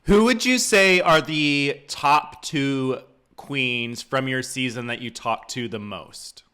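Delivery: normal talking voice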